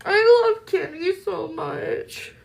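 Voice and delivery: high, slow, tear-choked affection; tearful. Real, not acted. Streamer gushes about Kenny on stream, tearful. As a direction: melt into tears of gratitude. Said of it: "sitting here possibly crying".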